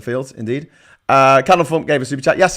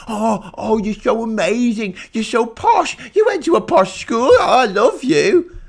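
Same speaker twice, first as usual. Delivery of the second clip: much higher, gushing mock adoration; mock fawning; 8 semitones higher than usual. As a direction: swoon over the posh boy, slowing at the end.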